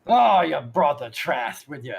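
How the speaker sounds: loud, drawn-out complaint; disgusted Moneybags. Acted. Moneybags to players after the knocking, loud drawn-out accusation. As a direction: groan it accusingly, loudly. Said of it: "looks at you and goes".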